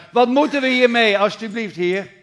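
loud, high pleading cry; desperate scream. Retold or imitated. Frightened servant pleads with the Lord, loud and high. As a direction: beg from the depths, out loud. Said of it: "dat hij schreeuwde uit het diepste".

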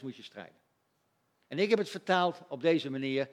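ordinary delivery

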